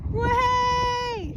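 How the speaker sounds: excited. Real, not acted. Reaching the summit.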